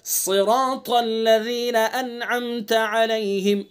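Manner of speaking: slow melodic tajweed chant; Quran recitation. Shown as a demonstration. Teacher reciting the verse slowly for students. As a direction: draw each syllable out in recitation.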